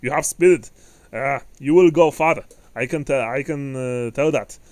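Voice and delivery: thunderous, loud dwarf voice; booming voice. Acted. Dwarf approves of a spirited manling, booming. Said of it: "booming voice".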